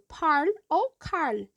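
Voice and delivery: parl and carl with the tongue flipping back, r into l; r and l blended. Shown as a demonstration. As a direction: roll the tongue back like a Minas accent. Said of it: "a língua, ela dá uma, uma virada".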